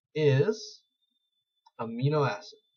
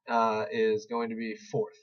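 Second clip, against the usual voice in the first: ordinary talk, nothing marked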